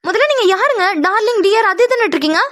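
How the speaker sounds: high-pitched, offended challenge; indignant. Acted. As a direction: demand an answer from a cheeky stranger. Scolds flirting stranger holding her.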